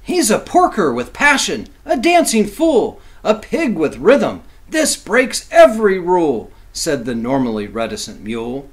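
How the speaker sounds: lively, sing-song picture-book reading; reading to children. A narrator's reading. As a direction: read it like bedtime rhyme for kids.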